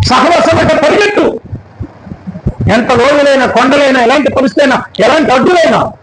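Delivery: high-pitched, driving sermon exhortation; rousing preaching. Real, not acted. Preacher urging the congregation to run to God's house, rousing preaching. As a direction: urge the congregation on in rhythmic, repeated calls.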